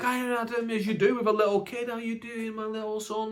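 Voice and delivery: high, sing-song fatherly hello; dad greeting child. Retold or imitated. Dad home from work to his little kid, high doting voice. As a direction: put on a cheery dad talking to a little one. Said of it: "Dad's come home from work".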